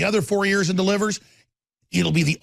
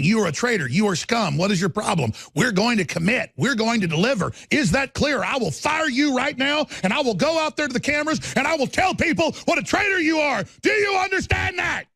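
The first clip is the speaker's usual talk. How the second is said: angry tirade that climbs higher and ends in a shout; imitating Trump screaming; 6 semitones higher than usual. Trump berating a disloyal lawmaker, mock furious shout. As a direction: chew out a traitor, building to a yell. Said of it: "he will start screaming".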